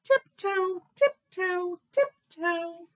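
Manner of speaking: high, evenly spaced little steps; dainty story-time sneaking; playful tiptoeing. A narrator's reading. Narrator acts tiptoeing.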